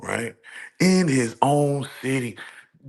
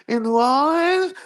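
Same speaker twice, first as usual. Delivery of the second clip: much higher, louder, almost a cry of disbelief; shrieking disbelief; 13 semitones higher, 5 dB louder than usual. Real, not acted. Podcaster reacting to the Super Bowl booking, squealing in disbelief. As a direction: push the question up into a squeal of disbelief.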